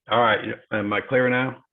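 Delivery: ordinary delivery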